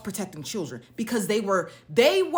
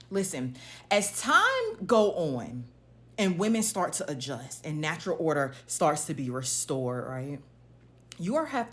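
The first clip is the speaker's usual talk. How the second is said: ordinary talk, nothing marked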